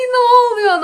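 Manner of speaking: high, loud alarmed question; retold startled outcry; startled disbelief. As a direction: sound thrown by the news.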